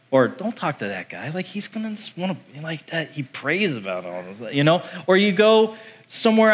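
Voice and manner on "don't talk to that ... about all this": acted aside. Coworkers warn each other aside.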